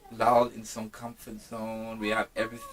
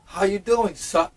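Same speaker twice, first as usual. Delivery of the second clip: higher, louder, mock-proper sir greeting; formal polite voice; 6 semitones higher, 11 dB louder, slower than usual. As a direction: play a stiff, overly polite gentleman. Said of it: "It's like".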